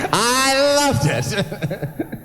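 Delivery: slow words broken by real laughter; laughing delight. Real, not acted. MC laughing to the audience about Lila, delighted. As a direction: laugh through it, loving the moment. Delighted at caller's stunned silence.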